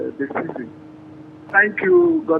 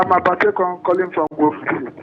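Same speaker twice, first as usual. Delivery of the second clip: raised voice to be heard on the phone; speaking up. Real, not acted. Caller repeating his introduction to the radio host, speaking up. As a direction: say it up so the host can hear. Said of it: "can you speak up a bit".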